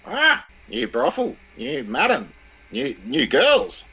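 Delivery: shrill, high and slow bird screech; squawking parrot. Acted. Parrot greeting the daughters home from school, high squawk. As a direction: draw it out like a mocking parrot. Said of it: "the parrot arcs up with".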